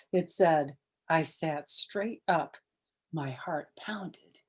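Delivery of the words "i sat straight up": ordinary delivery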